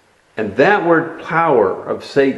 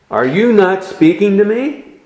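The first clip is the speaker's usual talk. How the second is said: sharp, raised demand; asserting authority; 8 semitones higher than usual. Acted. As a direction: Pilate pressing a silent prisoner. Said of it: "he asserts his authority".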